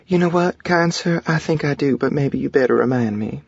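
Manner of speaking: put-on high, sweet Southern belle accent; cute southern accent. A narrator's reading. Wife teasing her husband by the fountain, cute southern accent. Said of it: "In her cute southern accent".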